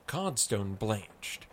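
plain reading voice

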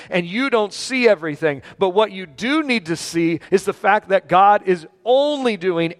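fervent preacher punching key words; impassioned preaching. Acted. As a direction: drive the point home to a congregation. Preaches the lesson forcefully.

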